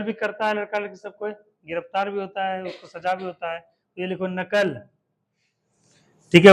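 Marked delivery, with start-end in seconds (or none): none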